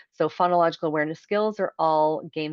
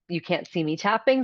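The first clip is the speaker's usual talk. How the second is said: ordinary talk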